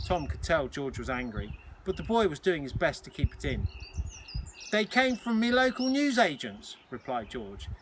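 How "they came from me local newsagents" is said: put-on a light Cockney boy's voice, pitched up; lightly voiced Cockney lad; a hint of a Cockney schoolboy. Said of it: "George's Cockney accent".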